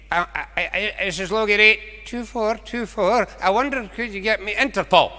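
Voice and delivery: lightly voiced old farmer spelling out his number; old farmer on the phone. Old farmer repeating his number to Dundee, lightly voiced.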